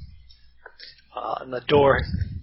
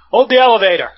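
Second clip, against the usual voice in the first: loud, higher-pitched shout; shouted call; 7 semitones higher, 9 dB louder, faster than usual. Acted. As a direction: call out to stop the lift. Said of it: "someone shouts at you".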